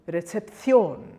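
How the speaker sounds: recepción with a long, strong final CIÓN; accented-syllable stress. Shown as a demonstration. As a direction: land hard on the end of recepción. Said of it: "syllable that needs to be stressed".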